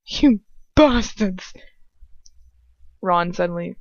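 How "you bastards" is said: slow, drawn-out angry curse; sudden outburst. A narrator's reading. Ron cursing his parents' captors, outburst. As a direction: spit it out in acted fury. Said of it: "bursts out suddenly".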